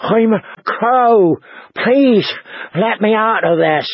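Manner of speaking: high, halting, desperate plea; anxious, high-voiced captive; frightened fat man. A narrator's reading. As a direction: beg to be freed, short of breath. Trapped fat man begs for release.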